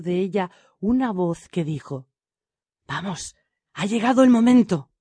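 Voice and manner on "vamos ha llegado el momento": louder, rising character voice; urgent call. A narrator's reading. Thief speaking up from inside the jar, urgent. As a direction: call out that it is time to act.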